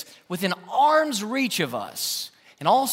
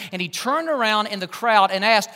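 ordinary talk

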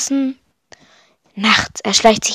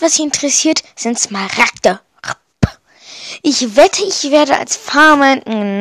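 ordinary delivery

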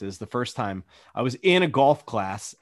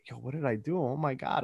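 put-on meek kid voice; 8 dB quieter, faster than usual. Scared kid after dad's outburst.

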